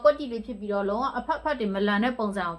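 talking through face mask; wearing a sheet mask. Real, not acted. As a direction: face covered, lips free.

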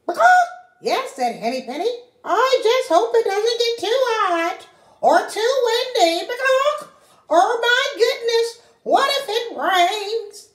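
high, fretful hen voice, tail word squawked; fussing anxious little hen; worried hen. Acted. Henny Penny fretting to Ducky Lucky about the weather, high and fussy.

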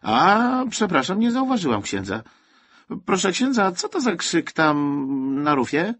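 high-pitched, effusive apology turning to inquiry; flustered apology. A narrator's reading. Woman realises she scolded a priest, flustered. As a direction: raise the voice in flustered politeness.

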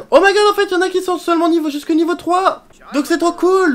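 high, loud, quick excitement; excited. Real, not acted. Player thrilled her Sim child skips potty training, excited. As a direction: gush about good news.

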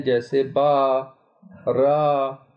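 बा, रा with long aa; drawn-out alif. Shown as a demonstration. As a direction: stretch the alif in बा and रा. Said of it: "अलिफ मद्दा कहते हैं". (अलिफ को खींचकर बा, रा)

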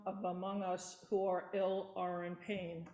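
ordinary talk

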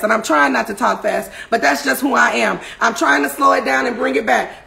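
usual speaking voice

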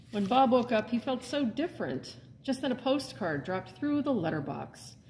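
lively, expressive storybook read-aloud; animated picture-book narration; reading to children. A narrator's reading. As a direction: read it like story time for little ones. Librarian reads picture book aloud.

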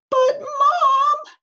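put-on high, loud child's cry of dismay; high-pitched, gasping little boy's plea; gasped protest. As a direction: call out to Mom in alarm. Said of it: "gasped Eddie".

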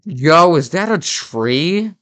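loud burst of fake excitement, then a slow, drawn-out question; mock amazement. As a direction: gasp at the tree like it's a miracle.

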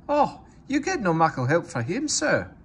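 sly Scottish engine excuse; Scottish accent. A narrator's reading. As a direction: innocent Scottish twins to the boss. Scottish twins.

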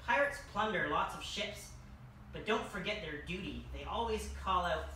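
ordinary delivery

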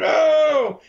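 high yelped mock scream of pain; retold scream. Victim screams as he's whacked with a hose, mock yelp. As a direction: yelp it like a man getting whacked. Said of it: "he started screaming".

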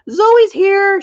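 high, loud squawking bird; shrieking parrot. Acted. Parrot announces the bat at the window. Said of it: "shrieked Utu".

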